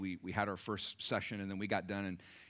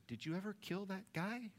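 timid questioner; 11 semitones higher, 4 dB quieter than usual. Retold or imitated. A listener's question, voiced.

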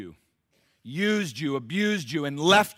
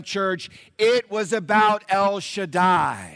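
slow, louder and higher, rising fervour; preaching build; 6 semitones higher, 5 dB louder than usual. Real, not acted. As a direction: build the proclamation line by line.

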